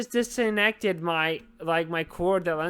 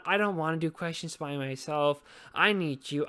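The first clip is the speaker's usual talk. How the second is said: ordinary talk, nothing marked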